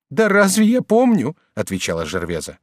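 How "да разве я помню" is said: high, loud woman's protest; flustered woman. A narrator's reading. Woman questioned by lover afterwards, flustered. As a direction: toss the question back in a lifted voice. Gervaise protests she cannot remember.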